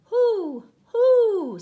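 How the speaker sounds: loud, high drawn-out hoots; angry owl. Acted. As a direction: hoot crossly like an owl. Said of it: "said the owl angrily".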